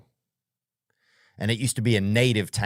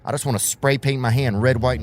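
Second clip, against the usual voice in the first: ordinary delivery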